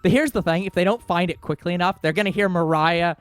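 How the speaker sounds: loud, high, slow villain shout; hammed-up furious screech; mock Mariah yelling. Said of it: "Thieves, get back here!".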